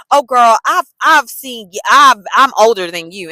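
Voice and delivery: loud mock condescension; older coworker. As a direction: talk down to a younger colleague. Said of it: "she was like".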